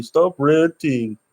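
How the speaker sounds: mocking critics. Mimicking people who tell him to stop ranting, mock-nagging. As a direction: sneer it back like a scold. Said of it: "they always tell you".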